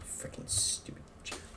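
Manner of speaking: whispered insult under the breath; hushed, mocking jab to himself; whispered aside. Real, not acted. Muttering to himself about Jeremy, whispered.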